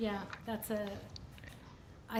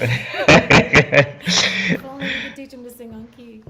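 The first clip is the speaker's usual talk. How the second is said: laughing while teasing; 3 semitones lower, 6 dB louder, slower than usual. Real, not acted. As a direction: joke about Byron through real laughter. Teasing about the backup singer.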